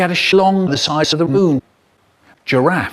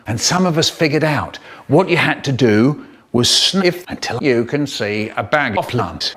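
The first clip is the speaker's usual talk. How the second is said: ordinary talk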